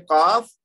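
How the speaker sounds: کاف said loud and slow from the back of the throat; stressed qaaf. Shown as a demonstration. Teacher stressing the throat letter کاف for students. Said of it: "تاکہ آپ پہچان سکیں کہ فرق".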